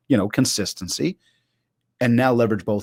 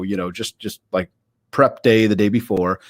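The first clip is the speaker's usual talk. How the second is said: ordinary delivery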